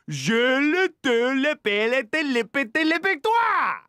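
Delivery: very high, loud fake French babble; mock French gibberish. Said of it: "And he goes".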